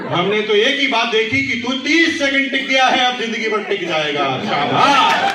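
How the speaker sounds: high, booming praise; loud encouragement. Retold or imitated. Mentor praising the speaker's first thirty seconds, loud encouragement. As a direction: cheer on a beginner with full voice.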